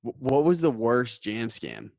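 ordinary talk

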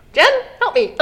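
whiny plea for help. Retold or imitated. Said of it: "It's like".

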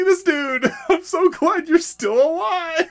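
high, drawn-out gushing hello; delighted reunion greeting. Acted. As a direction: squeal it like greeting a long-lost pal. Acted delighted reunion with old character.

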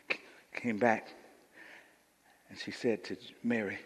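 low, very soft narration; hushed sermon storytelling. Acted. Preacher telling how Mary was called, hushed storytelling. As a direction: draw them in with a near-whisper.

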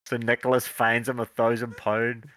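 ordinary talk

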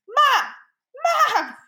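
put-on high, loud kid yelling for mom; imitated yell. Mother imitating her daughter yelling for her, yelled. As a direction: holler it high like a child. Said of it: "Sienna was yelling".

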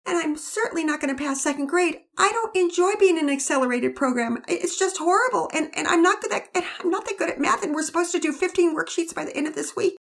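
put-on high girl's voice, anxious and complaining; a little girl dreading school; young girl fretting. Girl to her family about school, high and anxious.

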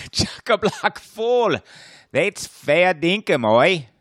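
slow, high mock Australian accent. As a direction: play a cartoon Australian, slowly. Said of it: "our accents aren't gonna carry us".

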